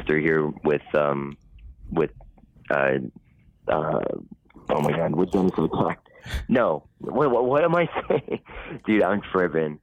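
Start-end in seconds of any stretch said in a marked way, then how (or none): none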